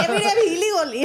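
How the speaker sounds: put-on playful Indian accent, high and loud; laughing Indian accent. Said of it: "this is my Indian accent".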